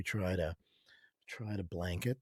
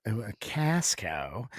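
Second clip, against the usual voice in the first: ordinary talk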